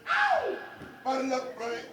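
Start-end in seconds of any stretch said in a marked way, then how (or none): 0.1-0.6 high yelp. Real, not acted. Drill call response.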